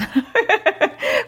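high laugh. Real, not acted. Laughs at her own remark.

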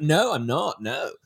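protesting retold no; retold denial. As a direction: deny it hastily, imitating your younger self. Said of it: "I'd have to deny it".

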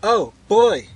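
put-on high, loud girl's exclamation; breaking off. A narrator's reading. Girl interrupts herself to the boy, exclaiming. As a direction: cry out in sudden protest. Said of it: "she broke off".

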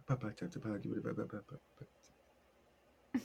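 soft, slow babble fading away; mumbled nonsense. Retold or imitated. Flustered while showing Phil his portfolio, soft mumbling babble. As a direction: trail off into soft gibberish. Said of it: "And I'm like".

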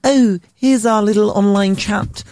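high-pitched chatbot voice; sing-song, high automated helper; rudimentary AI. Acted. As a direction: pitch it up like a mock customer-service bot. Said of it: "really rudimentary A I that's like".

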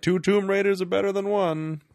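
high, loud hammed-up movie delivery; mock movie line. Mocking the film's line to a co-host, high and loud. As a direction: overplay the cheesy tagline, up high. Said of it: "they're like".

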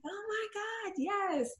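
high, soft mock squeal; thrilled client. Client being shown the frame, thrilled high squeal. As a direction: imitate delight in a small high voice.

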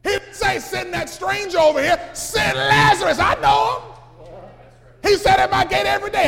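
loud, high preaching shout; preaching at full force. Acted. Preacher to church, loud high preaching shout. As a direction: drive it home to the congregation.

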